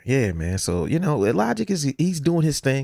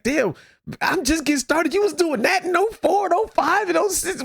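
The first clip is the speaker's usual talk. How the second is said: high-pitched mock amazement; imitated amazement. Host retelling younger artists' reaction to old records, mock amazed.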